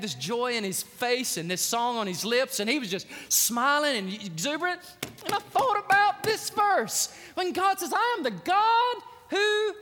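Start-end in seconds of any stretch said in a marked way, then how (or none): 5.2-9.7 high, emphatic scripture proclamation; ringing, high-pitched pulpit declaration; full-force preaching. Real, not acted. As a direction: declare God's words to the whole church.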